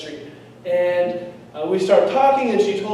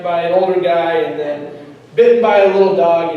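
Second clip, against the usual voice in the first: ordinary talk, nothing marked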